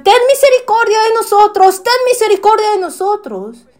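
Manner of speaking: high, loud desperate cry. Retold or imitated. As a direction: yell it to reach Jesus over the crowd. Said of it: "gritaron mucho más fuerte".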